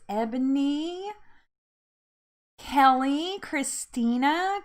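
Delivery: normal talking voice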